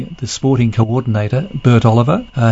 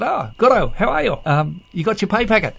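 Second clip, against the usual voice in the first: high voice; 8 semitones higher than usual. Retold or imitated. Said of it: "he said".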